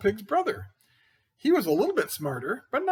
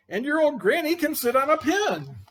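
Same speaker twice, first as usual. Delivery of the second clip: higher, louder rude yell; pig yelling; 10 semitones higher, 5 dB louder than usual. Acted. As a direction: fling the insult at the wolf. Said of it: "the third little pig yelled".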